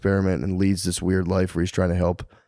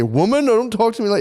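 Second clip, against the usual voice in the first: higher, hammed-up macho husband; mock husband; 13 semitones higher than usual. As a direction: bark at the wife in a mock voice. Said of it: "You say".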